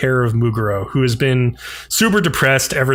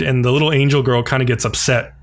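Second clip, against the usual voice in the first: ordinary talk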